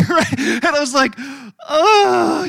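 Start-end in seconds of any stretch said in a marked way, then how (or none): 1.7-2.5 loud, high drawn-out "oh"; a caught-out, loud startled oh. Retold or imitated. Caught raging by watching nephews.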